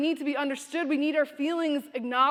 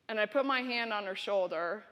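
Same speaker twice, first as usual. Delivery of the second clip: ordinary delivery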